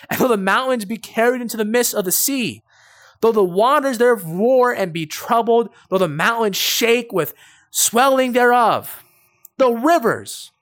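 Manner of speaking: preacher reads Psalm 46 with rising force; preaching scripture. Acted. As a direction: proclaim the verse to the congregation.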